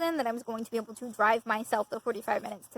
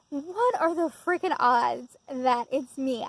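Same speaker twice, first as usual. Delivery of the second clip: normal talking voice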